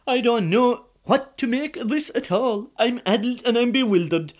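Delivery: high, loud flustered complaint; overwhelmed simple man fretting; bewildered husband. Acted. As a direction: fisherman flustered by the fuss. Colleen overwhelmed in palace.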